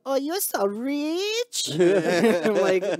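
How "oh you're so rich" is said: high, very slow put-on Filipina accent; mock Filipina stepmom. Stepmom receiving a small gift, mock Filipina accent. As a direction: drawl it out like an impressed Filipina stepmom. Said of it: "she just looks at me and goes".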